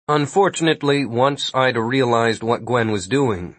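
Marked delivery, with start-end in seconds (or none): none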